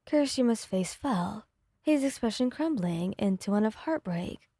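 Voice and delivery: usual speaking voice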